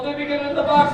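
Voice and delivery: drum-roll excitement; excited. Acted. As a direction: pump up the suspense.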